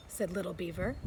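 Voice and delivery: plain reading voice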